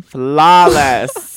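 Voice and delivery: loud, drawn-out proclamation; big, theatrical one-word answer; hammed-up exclamation. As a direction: belt out the verdict playfully. Playing the one-word answer game.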